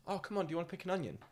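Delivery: quick, high, friendly invitation; lightly voiced eager farmer, fast; eager villager. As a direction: rush the invite out warmly. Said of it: "they just went".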